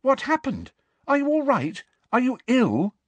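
high, anxious rush of concern; frightened. A narrator's reading. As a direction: ask in high-pitched alarm. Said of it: "looking very frightened".

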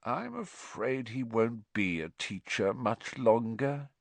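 soft, falsely regretful lilt; mock sorrow. A narrator's reading. Malfoy predicting Hagrid's sacking, in mock sorrow. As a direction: feign sadness, softly. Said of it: "in a tone of mock sorrow".

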